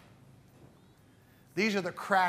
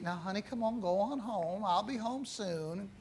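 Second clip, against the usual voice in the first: soft, sweet coaxing, much softer; sweetly coaxing; 8 dB quieter than usual. Retold or imitated. Preacher voicing the lady coaxing her puppy, soft and sweet. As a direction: coax the puppy home gently. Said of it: "very sweet".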